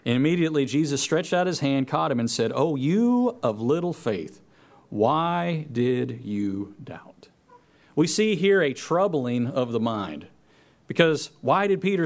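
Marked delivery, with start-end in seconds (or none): 2.5-7.3 slow, fading to a whisper; gentle rebuke. Acted. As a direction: tenderly chide the doubter. Jesus gently rebukes Peter.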